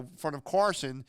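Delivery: normal talking voice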